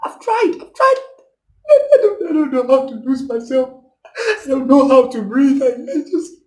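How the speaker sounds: high, tearful, breaking voice; a distraught actor losing it in high sobs; crying actor. Acted. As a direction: whimper the words through tears. Actor breaks down mid-monologue.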